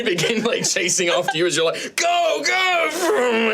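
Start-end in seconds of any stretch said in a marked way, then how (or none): 2.0-3.5 high urgent yell to flee; urgent shout. Acted. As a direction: hurry them on, shouting. Urging escape, shout heard.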